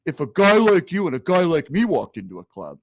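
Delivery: lightly voiced Dice Clay needling a front-row guy; a hint of Andrew Dice Clay ribbing the crowd; imitating Dice Clay. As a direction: toss him a smug, teasing question. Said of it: "He says".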